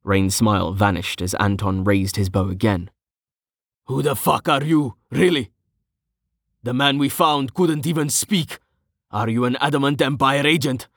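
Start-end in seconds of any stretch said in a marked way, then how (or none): 3.9-10.9 high-pitched, heated interrogation; pressing, suspicious grilling; accusing. A narrator's reading. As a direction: fire accusing questions, voice raised in pitch. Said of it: "he said accusingly".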